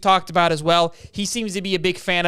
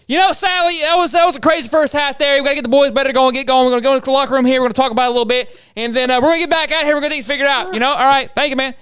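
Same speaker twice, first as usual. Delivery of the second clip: high, loud, excited coach interview voice; pumped-up coach talking to the sideline reporter; imitating a fired-up coach; 6 semitones higher, 5 dB louder, faster than usual. Halftime interview, coach to Sally, hammed-up and high. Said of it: "that's charismatic".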